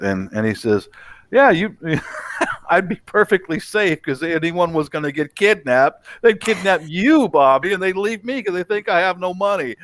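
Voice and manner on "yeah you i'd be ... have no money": laughing. Retold or imitated. Retold friend's joke with laughs.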